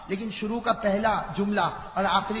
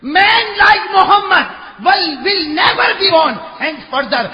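high, loud, slow proclamation; fervent shout; 9 semitones higher, 9 dB louder, much slower than usual. Shown as a demonstration. Preacher proclaiming the quote to English speakers, shouting. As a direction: ring out every word. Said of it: "سننا، جو انگریزی جانتے ہیں".

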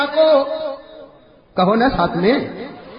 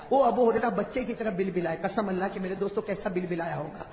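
hushed; 7 semitones lower, 10 dB quieter than usual. Real, not acted. Preacher describes weeping, emotional.

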